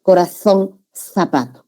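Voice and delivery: corazón, zapato with z as "th", tongue between the teeth; Castilian interdental Z. Shown as a demonstration. Teacher demonstrates the Spanish Z, corazón and zapato lisped as "th". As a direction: say the z of "corazón" and "zapato" like English th in think. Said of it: "esta es la Z española".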